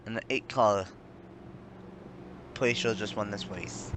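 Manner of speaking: usual speaking voice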